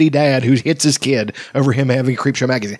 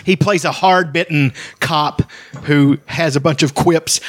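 ordinary delivery

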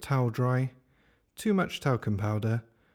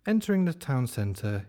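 ordinary delivery

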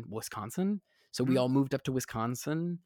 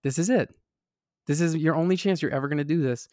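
higher, louder urgent self-talk; self pep talk; 4 semitones higher, 5 dB louder than usual. Retold or imitated. Actor on the elevator urging himself, urgent. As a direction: psych yourself up for your one shot.

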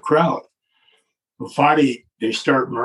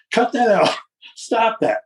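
whiny protest; 8 semitones higher than usual. Retold or imitated. Vince flinching from swagger stick.

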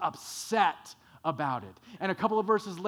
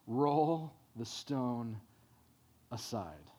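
calm command; 6 semitones lower, 4 dB quieter, slower than usual. Acted.

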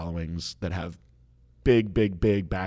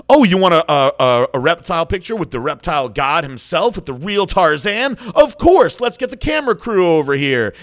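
much higher and louder, hammed-up braggart; mocking show-off; 7 semitones higher, 8 dB louder than usual. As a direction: lay it on thick like a self-important celebrity. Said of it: "make a mockery of the whole situation".

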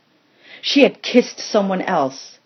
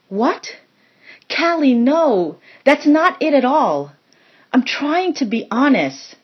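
Arizona protesting, higher and urgent; arguing; 7 semitones higher than usual. A narrator's reading. Arizona defending herself to Callie, high and urgent. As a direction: push back hard, pleading to be believed. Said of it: "Arizona argued".

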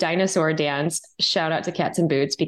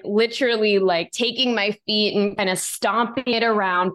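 usual speaking voice

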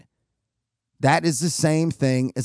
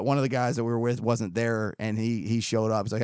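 normal talking voice

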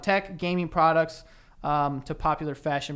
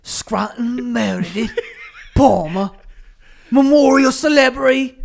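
louder and higher, cracking into a laugh; hammed-up sexy voice; 8 semitones higher, 13 dB louder than usual. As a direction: overdo the seduction until it breaks. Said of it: "Be sexier".

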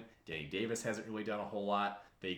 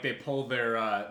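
ordinary delivery

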